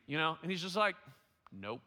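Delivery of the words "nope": deadpan imitation of a blunt refusal; flat affect. Friend shoots down the shared word, completely flat. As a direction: answer with no feeling at all. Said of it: "just completely flat affect".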